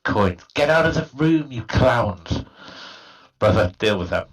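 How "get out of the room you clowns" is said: snapping at the intruders; angry shout. Acted. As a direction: yell them out of the room. Shouting at brothers.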